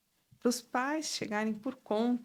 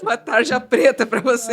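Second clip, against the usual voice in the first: loud, laughing punchline; teasing through laughter; 15 dB louder than usual. Real, not acted. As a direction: toss the joke out loudly while laughing. Teasing joke amid group laughter.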